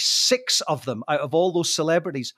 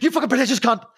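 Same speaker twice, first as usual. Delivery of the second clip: higher, rushed mock insult; retold coked-up outburst; 6 semitones higher, 3 dB louder, faster than usual. Storyteller corrects how he said it on cocaine, rushed mock outburst. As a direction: blurt it out in one quick burst. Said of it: "first time I did cocaine, I probably said".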